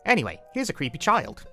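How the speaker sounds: usual speaking voice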